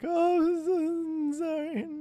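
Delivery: very high, stretched-out squeaky sorry; exaggerated high drawn-out apology; mock apology. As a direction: squeal it slowly in put-on regret. Mock apology after fall.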